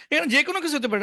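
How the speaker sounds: ordinary talk, nothing marked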